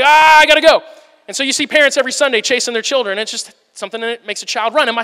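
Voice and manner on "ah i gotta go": put-on loud, high burst of a kid who has to run; excited kid. A kid spotting the big hallway, loud and eager. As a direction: blurt it out like a child bolting. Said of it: "they're just like".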